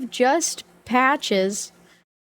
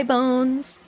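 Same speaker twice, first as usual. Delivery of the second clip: normal talking voice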